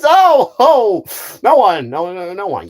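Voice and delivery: startled, loud and high, then settling; Buzz Lightyear. Acted. Space ranger caught talking to a bug, startled cover-up. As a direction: deny it hastily, caught off guard. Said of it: "he's gonna be a great Buzz Lightyear".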